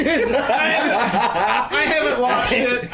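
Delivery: laughing through the words. Real, not acted. Co-host laughs while denying he watched it. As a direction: giggle out the answer.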